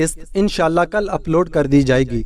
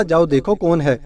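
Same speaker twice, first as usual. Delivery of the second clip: plain reading voice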